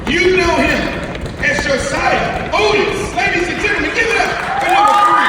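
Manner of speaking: loud, driving ceremony announcer; hype announcer. Real, not acted. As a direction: pump up the crowd with each name. Hype announcer introducing graduate.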